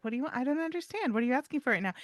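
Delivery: high, mock-innocent questions; feigned confusion. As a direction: play dumb to tease the dog. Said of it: "play like I didn't understand".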